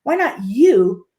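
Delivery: ordinary talk, nothing marked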